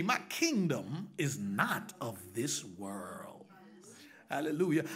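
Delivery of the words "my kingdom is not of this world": slow hushed whisper of a holy secret. Acted. Preacher whispers Jesus's words to the congregation. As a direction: drop to a breathy whisper for reverence.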